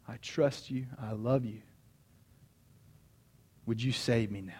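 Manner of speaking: earnest hushed prayer of surrender; leading a prayer. Real, not acted. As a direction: pray quietly, phrase by phrase, leaving room to repeat. Leads a prayer.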